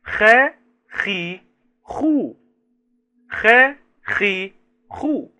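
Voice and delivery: sing-song phonics of خ، خي، خو; letter sounds. Shown as a demonstration. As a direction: climb the ladder خ، خي، خو for kids. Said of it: "تعالوا نتعرف على صوتيات حرف الخاء".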